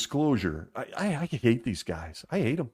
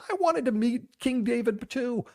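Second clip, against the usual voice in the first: put-on childlike voice; 13 semitones higher than usual. Voicing his child self.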